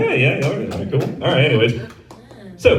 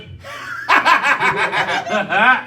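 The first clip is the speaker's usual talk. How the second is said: long, high mock cackle; drawn-out gleeful laugh, acted; imitated child's laugh; 5 semitones higher than usual. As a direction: imitate a toddler's merciless giggling fit. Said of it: "'cause he just went".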